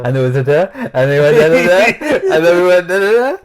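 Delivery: put-on loud, high toddler voice. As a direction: babble it like a toddler. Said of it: "told that story like a toddler would".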